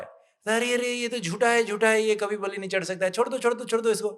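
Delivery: high-pitched, urgent protest; alarmed chief. Retold or imitated. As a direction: call off the ritual in acted alarm.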